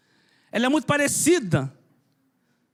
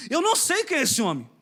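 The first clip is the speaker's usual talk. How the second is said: Peter's denial; 5 semitones higher than usual. Retold or imitated. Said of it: "é o que Pedro fez".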